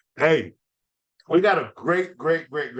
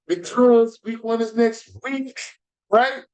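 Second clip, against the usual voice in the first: hyped up; 7 semitones higher, 4 dB louder, slower than usual. Real, not acted. Hyping week one.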